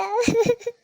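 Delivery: high-pitched sob; acted crying; sobbing. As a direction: dissolve into tears. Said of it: "ಅಳ್ಬೇಡ".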